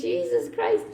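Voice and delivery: breathy, high exasperated Jesus Christ, imitating the coordinator; a shocked, airy exclamation, retold; exasperated gasp. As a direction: coordinator hearing about the mistake, mock exasperated. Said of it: "And she was like".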